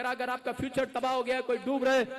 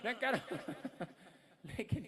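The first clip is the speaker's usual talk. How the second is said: laughing; 2 semitones lower, 9 dB quieter, slower than usual. Real, not acted.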